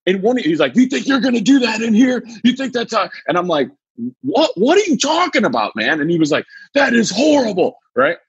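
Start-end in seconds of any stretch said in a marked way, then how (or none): 0.8-3.1 angry teacher. Retold or imitated. Teacher yelling at student. 4.2-6.0 high, bewildered protest; confused protest. Retold or imitated. 6.7-7.8 high, outraged scolding; outraged teacher. Retold or imitated.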